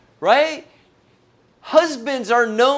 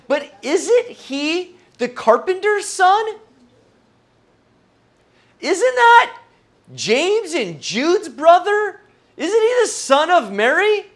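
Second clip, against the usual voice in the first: higher, incredulous questioning; sceptical townsfolk; 6 semitones higher than usual. Retold or imitated. Hometown crowd questioning Jesus, lightly voiced incredulous scoffing. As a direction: scoff like doubting neighbours.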